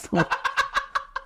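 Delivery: airy high-pitched cackle; breathy high laugh. Acted. Phua Chu Kang laughing at his own threat, airy high cackle. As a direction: let out a soft high laugh.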